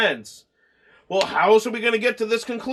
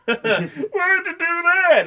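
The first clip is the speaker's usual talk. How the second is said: imitating a wounded whine, much higher and slow; hammed-up pained squeal; high whiny mock cry; 9 semitones higher, slower than usual. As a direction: whimper it like a hurt victim, half laughing. Retelling Richie hit with phone.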